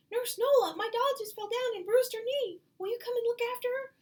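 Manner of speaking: put-on high loud breathless child's plea; urgent child. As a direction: burst in like a panicked kid. Child rushes in with emergency.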